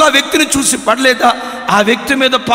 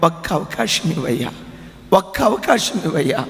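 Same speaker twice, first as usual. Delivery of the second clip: low, soft begging through tears; weeping plea; 5 semitones lower, 4 dB quieter than usual. Acted. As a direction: beg for one more chance, crying quietly. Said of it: "ఏడుస్తూ అన్నాడట". (ఏడుస్తూ వేడుకునే స్వరం)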